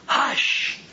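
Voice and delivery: forceful, high hush; stern hushing order; severe hush. Acted. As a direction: command silence in one word. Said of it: "saying it again".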